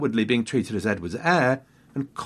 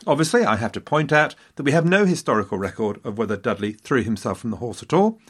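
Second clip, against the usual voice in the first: normal talking voice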